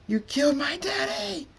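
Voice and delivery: very high, anguished shriek; screamed accusation. A narrator's reading. Aqua accuses her daddy's killer, screaming. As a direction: shriek it in grief and rage. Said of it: "Aqua screams".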